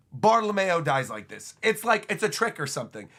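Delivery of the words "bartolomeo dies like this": normal talking voice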